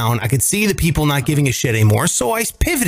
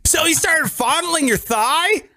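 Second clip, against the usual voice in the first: high, slow, laughing disbelief; incredulous surprise; 11 semitones higher, slower than usual. Real, not acted. Friend reacting to the leg-touching story, high laughing disbelief. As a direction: react as if scandalized.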